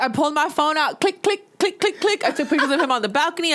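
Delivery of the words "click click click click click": camera click sounds. Retold or imitated. Mimicking phone camera clicks.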